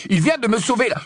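protestation. Acted. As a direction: protest over the shouting. Harry proteste en pleine dispute. (protestation pressante, voix haute)

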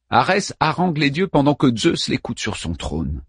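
plain reading voice

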